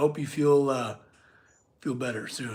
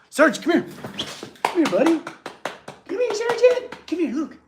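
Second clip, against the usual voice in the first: much higher, sing-song coaxing call to the dog; 10 semitones higher than usual. Real, not acted. Coaxing the shy shop dog over, high baby talk. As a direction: coo at the dog to come over.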